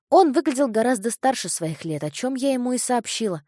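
usual speaking voice

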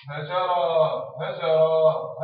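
هجرة said very slow and loud; slow syllable demo. Shown as a demonstration. Teacher modelling the new word for pupils, slow and loud. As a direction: spell out هجرة slowly. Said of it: "شو يولي؟".

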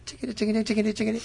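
high, rapid chattering sound effect; quick high-pitched imitation of clashing swords; imitated fight noises. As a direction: patter out a mock swordfight, high. Imitating quick jump cuts of fighting.

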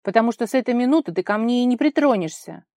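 angry shouting. A narrator's reading. Vara jealous of Vika, shouting at Kolya. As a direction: shout a furious ultimatum. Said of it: "крикнула она".

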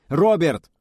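loud high call across the wreck. Acted. Young calls out to his drifting pilot, shouting. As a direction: shout his name to reach him.

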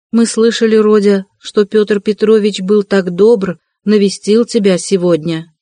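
ordinary delivery, nothing marked

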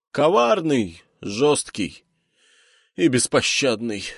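put-on high, loud, grand old scholar; proclaiming scholar. A narrator's reading. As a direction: declaim the demon's titles. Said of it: "вещал учёный".

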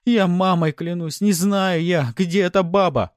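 loud, high, drawn-out pleading; frantic high-pitched yelling; desperate shouting. Acted. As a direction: swear it in a pained panic. Beaten bandit desperately denies knowing.

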